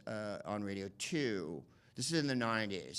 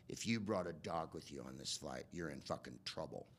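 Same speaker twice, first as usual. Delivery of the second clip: lower, softer, menacing official tone; a hint of a cold security announcement; lightly voiced stern governor warning; Kristi Noem impression; 7 semitones lower, 8 dB quieter than usual. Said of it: "and she's like".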